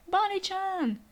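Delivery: high, drawn-out call of a name. Acted. Character calling to Bonnie, high acted call. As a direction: call out to her, pitched well up.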